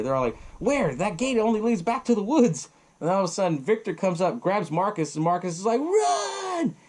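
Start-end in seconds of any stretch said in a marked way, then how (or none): 0.6-2.7 retold panicked protest. Characters argue while hiding. 5.8-6.8 long, very high scream of warning; desperate yell. Retold or imitated. Grabbed man yells to friends.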